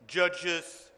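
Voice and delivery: ordinary talk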